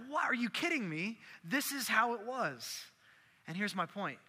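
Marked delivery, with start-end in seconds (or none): 0.1-2.8 high-pitched disbelief; incredulous. Acted. Preacher reacting to such raw songs sung in worship, incredulous. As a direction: pitch it up, astonished and insistent.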